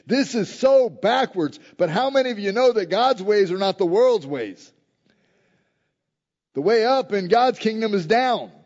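loud, high preaching build; preacher pressing the upside-down kingdom, loud and high; full-force preaching. Acted. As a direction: drive the point home to the congregation.

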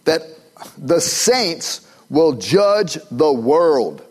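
slow, drawn out, pressing; slow preacher emphasis; slow emphatic quote. Acted. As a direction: weigh every word. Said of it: "So he says".

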